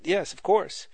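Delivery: ordinary delivery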